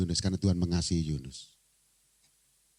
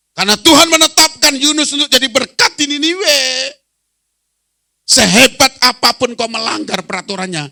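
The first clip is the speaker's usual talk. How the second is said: high, thundering sermon shout; full-force preaching; 14 dB louder than usual. Real, not acted. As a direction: proclaim it to the whole hall at full power. Berkhotbah dengan suara penuh. (berkhotbah lantang berapi-api)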